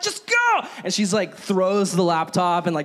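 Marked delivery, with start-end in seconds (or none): 0.0-0.7 high, loud urgent command; husband hurrying his wife away, shouting; panicked shout. Retold or imitated. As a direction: push her out the door with your voice. Urging wife to flee.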